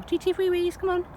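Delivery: high sing-song dog talk; coaxing the dog. Real, not acted. Owner urging her dog to go wee, high coaxing voice.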